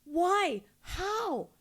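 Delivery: mock parents' disbelief, rising in pitch; questioning parents. Parents reacting to a child coming out, mock startled questioning. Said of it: "when they say".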